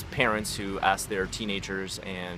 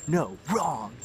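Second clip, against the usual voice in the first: higher, sharp mock reprimand; mock scolding; 7 semitones higher, 4 dB louder than usual. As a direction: play a teacher harshly scolding a mistake. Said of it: "I don't ever yell at 'em".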